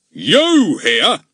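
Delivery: high, loud blustering constable; pompous policeman. A narrator's reading. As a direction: loom over the boy. Goon confronts Ern.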